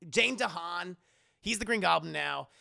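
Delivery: ordinary talk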